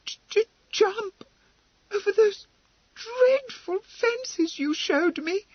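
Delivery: very high, stammering horror. Acted. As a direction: stammer it out, horrified. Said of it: "horror in her voice".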